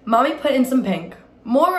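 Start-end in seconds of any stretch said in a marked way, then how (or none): 1.4-1.8 high, louder cry for more; a child crying out for more; eager childish cry. A narrator's reading. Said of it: "I cried".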